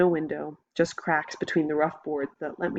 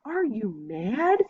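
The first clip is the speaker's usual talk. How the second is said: high, slow outraged shout; angry shout; 5 semitones higher, much slower than usual. A narrator's reading. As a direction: bellow it in disbelief. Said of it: "he shouts".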